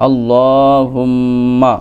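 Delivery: আল্লাহুম্মা drawn out very slowly, in a chanted recitation tone. Shown as a demonstration. Teacher models আল্লাহুম্মা slowly for students. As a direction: stretch আল্লাহুম্মা slowly as in Quran reading. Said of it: "teacher recites the joined word".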